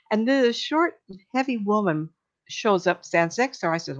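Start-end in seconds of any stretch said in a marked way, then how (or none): none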